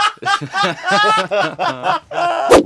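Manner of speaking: high, giggly laugh at himself; real laughing fit; burst of laughter. Real, not acted. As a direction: crack up mid-exercise. Laughing at the voice exercise.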